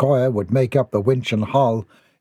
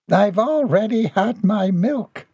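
high, slow sleepy voice; high-pitched groggy character voice; groggy murmur; 10 semitones higher, slower than usual. A narrator's reading. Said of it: "she murmured, groggy from sleep".

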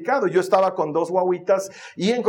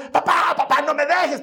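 put-on little girl pleading; 8 semitones higher than usual. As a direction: beg like a clingy little girl. Daughter voiced in car.

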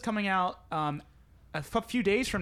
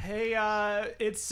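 ordinary talk, nothing marked

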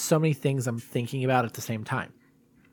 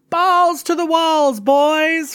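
movie quote impression; 13 semitones higher, 7 dB louder, much slower than usual. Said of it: "from down Periscope".

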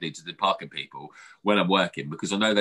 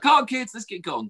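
higher, urgent call; hurrying the kids; 11 semitones higher than usual. Retold or imitated. Calling to the kids while running late. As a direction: hustle the kids along.

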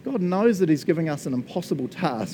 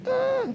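small high-pitched pretend scream; mock scream. Preacher pretends to scream with joy, high but held back. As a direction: let out a thin, restrained "scream". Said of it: "That's me screaming really loud".

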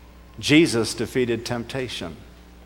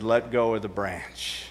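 calm quiet voice; 3 semitones lower, 10 dB quieter than usual. Retold or imitated. God's calm reply.

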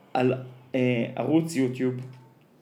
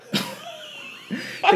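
burst of high laughter. Real, not acted. Retelling the show to a friend and bursting out in high laughter. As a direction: crack up at your own punchline. Cracking up at the quote.

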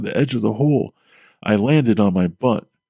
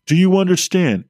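higher, louder insistent repeat; urgent demand; 7 semitones higher, 3 dB louder than usual. Acted. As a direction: press him hard for an answer.

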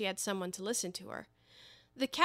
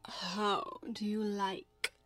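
slow, soft whisper; low voice; 7 dB quieter, much slower than usual. Acted. The cat slyly asking Alice about the Queen, whispered. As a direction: hush it so the Queen won't hear. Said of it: "said the cat in a low voice".

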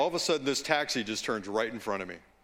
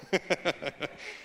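laughing; 3 semitones higher than usual. Real, not acted. Laughing at own slip.

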